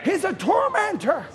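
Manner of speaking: loud, high exhortation; preaching shout. Real, not acted. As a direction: shout the warning at the congregation.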